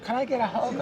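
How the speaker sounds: sweet lady. Retold or imitated. Cashier lady asks hug.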